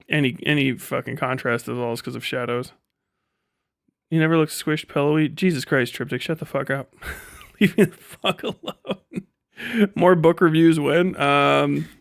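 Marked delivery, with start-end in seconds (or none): none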